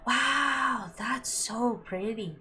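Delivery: loud, high, drawn-out wow; awed delight. Real, not acted. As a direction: gush slowly over something gorgeous. Awed reaction to the painting.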